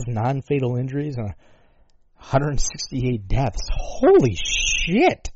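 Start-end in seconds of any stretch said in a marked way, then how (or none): 3.7-5.3 drawn-out high disbelief; slow, high-pitched astonishment; stunned holy shit. Real, not acted. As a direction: let the shock stretch the words out. Shocked at death toll.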